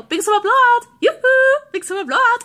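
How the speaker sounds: high, loud singsong catchphrase; mimicking the alarm. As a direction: chant along with a chirpy salesman alarm. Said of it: "we're doing it, like, along, along with the alarm".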